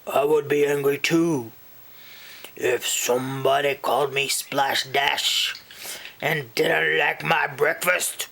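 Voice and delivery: odd put-on monster voice, pitch kept even; friendly monster under the bed; sympathetic monster. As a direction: take her side in a character monster voice.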